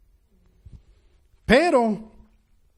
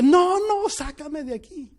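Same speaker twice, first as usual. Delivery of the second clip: high, pleading cry that drops away; mock anguished plea, high then fading; retold terrified plea; 7 semitones higher, 3 dB louder, slower than usual. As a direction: beg to be pulled out, terrified. Pleading from the flames.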